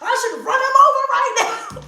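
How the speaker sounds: high, loud mock-furious rant; retold rage. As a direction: rant about him, hammed up for the story. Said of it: "I was so mad".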